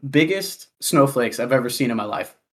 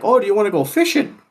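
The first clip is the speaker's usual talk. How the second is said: higher, quick, lightly voiced Wisconsin local; mimicked older local; 7 semitones higher, faster than usual. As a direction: lightly imitate an eager older coworker's invitation. Imitates older Wisconsin coworker inviting him.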